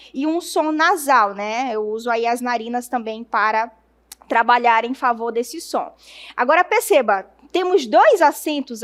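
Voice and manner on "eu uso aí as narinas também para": ordinary delivery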